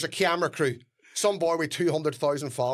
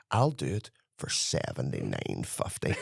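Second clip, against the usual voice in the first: much lower, smug undercutting voice; lowball musician; 14 semitones lower, 4 dB quieter than usual. Retold or imitated. A rival musician undercutting at the bar, low and sly. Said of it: "And the boy goes".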